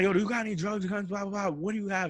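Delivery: high-pitched imitation of a pushy cop; officer's questioning. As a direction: rattle off questions as a demanding officer. Voices the officer questioning him.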